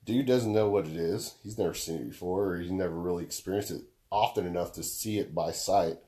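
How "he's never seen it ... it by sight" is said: ordinary delivery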